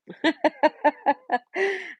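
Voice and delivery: high laugh. Real, not acted. Laughing at cough drop sound.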